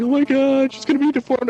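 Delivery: high-pitched mock wail; Vicky crying. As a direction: imitate a distraught mother fretting over her baby. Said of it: "Vicky would be, like, crying".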